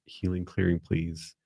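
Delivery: ordinary talk, nothing marked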